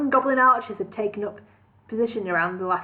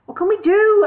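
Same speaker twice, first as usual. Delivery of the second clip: desperate cry; 11 semitones higher, 9 dB louder than usual. A narrator's reading. Desperate hero cries out.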